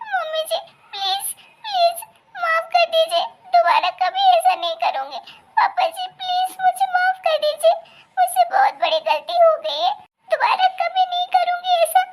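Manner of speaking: high, weepy begging for forgiveness; tearful pleading. Acted. Daughter-in-law begging her in-laws' forgiveness, high and tearful. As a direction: sob out the apology, begging. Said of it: "जब तू ये रोना बंद करेगी".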